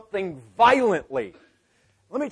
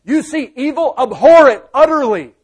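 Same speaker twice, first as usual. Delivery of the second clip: loud, urgent command, much higher and louder on grab it; God's command; 5 semitones higher, 9 dB louder than usual. Retold or imitated. Preacher voicing God telling him to grab good, booming command. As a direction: bark God's orders like a drill call. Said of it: "and He tells me".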